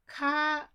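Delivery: cotton said too loud and high, marked wrong; overdone cotton. Shown as a demonstration.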